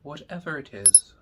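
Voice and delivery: soft, slow meditation voice with long pauses; guided meditation. Acted. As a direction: guide listeners gently inward, hushed and unhurried.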